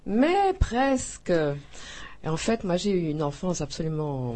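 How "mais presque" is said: high, slow laughing aside. Real, not acted. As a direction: laugh the words out slowly and high. Jokes about life before birth.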